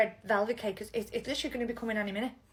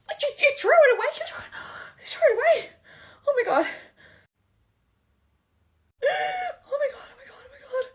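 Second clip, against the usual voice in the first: much higher, louder panicked shriek; shrieking jackpot winner; 13 semitones higher, 9 dB louder, faster than usual. Acted. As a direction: shriek in horror with gasps. Ring thrown away in the bin.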